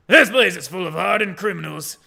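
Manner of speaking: put-on loud governor with a rough Scottish accent; badly faked Scottish, played big; attempted Scottish accent. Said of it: "do a Scottish accent".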